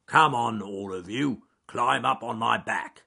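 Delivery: firm bear calling the animals; a hint of a growly bear. A narrator's reading. Charles Growly Bear calling the animals onto his back, firm growl. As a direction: gather them up, steady.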